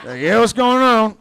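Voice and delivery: loud, high, drawn-out dad voice. Retold or imitated. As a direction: answer the phone loudly and slowly as a dad. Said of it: "My dad's like".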